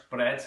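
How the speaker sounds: Scouse trill in "Bread"; rolled R. Shown as a demonstration. Teacher repeating the word, "Bread" with rolled R. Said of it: "Again, rolling the R's".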